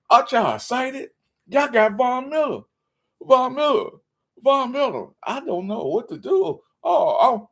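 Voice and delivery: high-pitched, gushing fake delight; mock overwhelmed joy. Host pretending to be thrilled over Von Miller, mock gushing. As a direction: gush as if overcome by the news.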